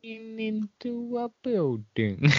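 drawled high, slow mimic line; a lightly done Matthew McConaughey drawl; Matthew McConaughey impression. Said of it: "Yep, that's it".

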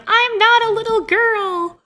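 cartoonish high yell, like a cat squeaking; squeaky little-girl yell. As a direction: yell it like an angry small child.

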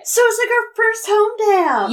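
gasp, then high-pitched excitement; excited realization. Real, not acted. Co-host reacting to a listener's personal story, excited. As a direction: burst out with thrilled surprise.

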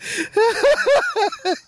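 helpless high laughter; long high laugh. Real, not acted. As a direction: laugh till you can't stop. Cracking up at joke.

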